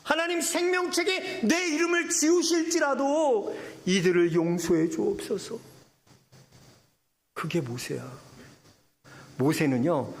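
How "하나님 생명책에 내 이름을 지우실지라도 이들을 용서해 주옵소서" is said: earnest pleading prayer. Acted. Moses prays for the people.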